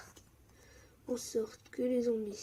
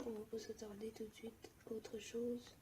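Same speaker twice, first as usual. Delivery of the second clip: softer whispered planning; 16 dB quieter than usual. Real, not acted. Gamer whispers his plan to viewers, hushed. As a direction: murmur the plan under your breath.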